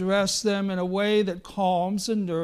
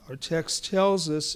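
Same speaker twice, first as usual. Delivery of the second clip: normal talking voice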